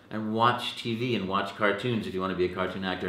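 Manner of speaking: usual speaking voice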